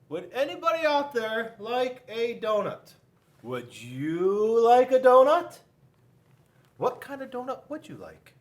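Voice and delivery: hawking donuts to everyone; a loud vendor's call. Acted. Panda calling out to all.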